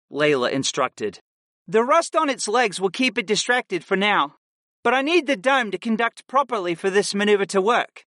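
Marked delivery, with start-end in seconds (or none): 1.7-8.0 lightly voiced steady instructor; calm young woman voice. A narrator's reading. Layla instructing.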